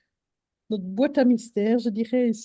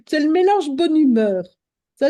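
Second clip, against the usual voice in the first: ordinary talk, nothing marked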